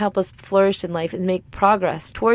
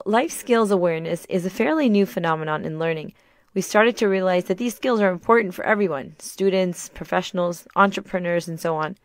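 ordinary talk

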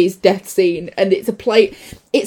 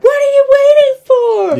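much higher, slow and loud; hammed-up dramatic cry; 4 dB louder than usual. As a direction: fling it at the killer melodramatically. Voicing Jennifer Love Hewitt scene.

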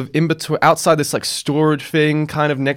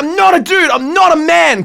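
high, loud mock indignation, slowing on respect; indignant yell; 12 semitones higher, 4 dB louder than usual. Offended man yelling at the driver for calling him dude, indignant. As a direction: demand respect in a shrill huff.

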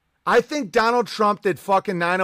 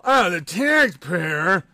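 slow, put-on sneering voice; mocking drawl; much slower than usual. Streamer mocking a super chat about taxpayers. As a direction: stretch it out like a whiny taxpayer.

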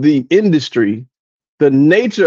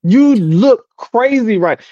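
exasperated; 7 semitones higher than usual. Retold or imitated. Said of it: "And it's like".

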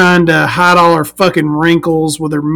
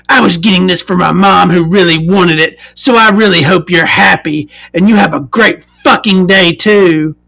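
indignant buyer voice; 3 semitones higher, 3 dB louder than usual. Retold or imitated. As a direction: threaten the review in a shrill put-on voice. Buyer's angry message voiced.

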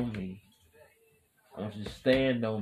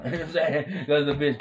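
laughing; 6 semitones higher, 6 dB louder than usual. Real, not acted. Laughing while talking.

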